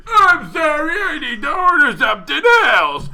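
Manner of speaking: high, loud whiny put-on voice; mock the driver with a whiny wail. Mocking slow driver.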